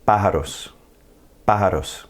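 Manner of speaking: "Pájaros" stressed on opening PÁ; stress on accented A. Shown as a demonstration. Teacher models accent over A in Pájaros, stressed PÁ. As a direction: punch the first syllable of "Pájaros". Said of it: "where we're gonna put our stress".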